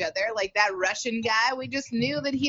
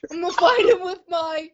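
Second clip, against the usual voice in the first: laughing; 4 semitones higher, 3 dB louder than usual. Real, not acted. Laughing through a joke.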